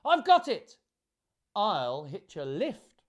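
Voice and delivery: high, loud burst, then easing; excited cry. A narrator's reading. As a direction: exclaim a sudden bright idea. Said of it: "then cried".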